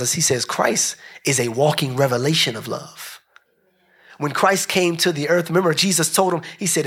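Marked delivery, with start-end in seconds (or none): none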